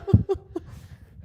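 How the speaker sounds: high breathy burst of laughter. Real, not acted. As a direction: laugh out as the ball passes. Laughing during ball pass.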